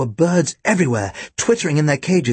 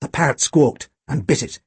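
ordinary delivery

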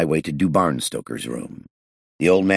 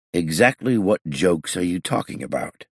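plain reading voice

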